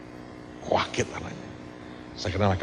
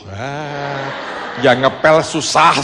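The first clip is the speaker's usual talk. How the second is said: loud, laughing punchline; laughing joke; 4 semitones higher, 8 dB louder, much slower than usual. Real, not acted. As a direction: crack up as you say it. Joking reply with laughter.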